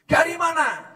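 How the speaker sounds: "Dari mana?" asked high, falling on "mana"; demanding wife's high question; mock nagging wife asking "Dari mana?" up high; high-pitched question. Wife again questions husband.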